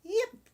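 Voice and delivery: sudden high dog yelp; terrified yip. A narrator's reading. Stung dog yelps in terror. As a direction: yelp like a frightened puppy. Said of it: "With a terrified".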